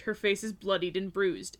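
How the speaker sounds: ordinary talk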